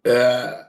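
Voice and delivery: a sudden loud real burp. Real, not acted. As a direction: let a burp slip out mid-talk. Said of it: "that burp was gonna come".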